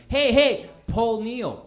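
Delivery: high, loud blurt of a wrong name; panicked call. Acted. Fan who forgot the star's name, panicked shout. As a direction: call out in a panic for his attention. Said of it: "you know when you panic".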